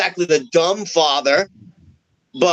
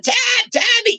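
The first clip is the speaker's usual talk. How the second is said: high panicked call of her name; frantic screaming; slower than usual. Retold or imitated. Father calling out for his daughter, high frantic scream. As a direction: yell it out desperately, pitch way up. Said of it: "screaming like a fucking mother".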